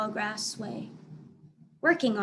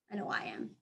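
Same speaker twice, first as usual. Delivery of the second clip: ordinary delivery, nothing marked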